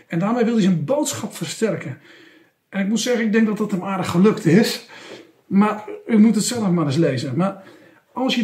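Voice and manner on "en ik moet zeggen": normal talking voice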